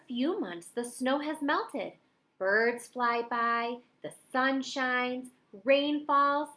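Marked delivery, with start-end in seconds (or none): none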